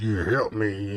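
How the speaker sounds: low, put-on deep voice; mock deep-voiced plea. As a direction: drop the voice way down for the trapped man. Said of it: "He got a deep tone voice".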